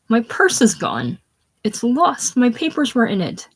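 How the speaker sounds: high, acted distress; shocked cry. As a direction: cry out in alarm over a loss. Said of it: "shocked cry".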